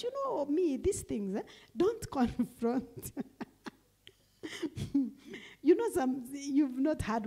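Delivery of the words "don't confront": laughing through a slow word. Real, not acted. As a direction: drag it out, laughing.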